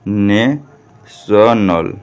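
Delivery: National very slowly, ने, then शनल after a pause; syllable-by-syllable. Shown as a demonstration. Teacher repeats the word for learners, slowly syllable by syllable. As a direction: break national into drawn-out syllables. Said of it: "इसे हम लोग क्या पढ़ते हैं?".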